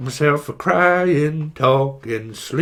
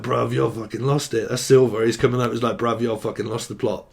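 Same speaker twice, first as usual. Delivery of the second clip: lower, softer mock bloke voice; mock skeptic; 8 semitones lower, 4 dB quieter than usual. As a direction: grumble it like a dismissive mate. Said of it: "they're like".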